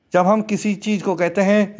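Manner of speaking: normal talking voice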